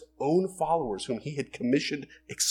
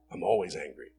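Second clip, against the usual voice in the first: Hulk quote; 9 semitones lower, 4 dB quieter than usual. Retold or imitated. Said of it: "think of the Hulk".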